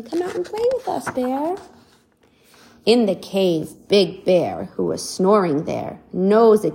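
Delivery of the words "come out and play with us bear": high, fast, coaxing call; brave colt calling. Acted. Little colt coaxing the bear, high and quick. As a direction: coax the bear out cheerfully. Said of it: "He's saying".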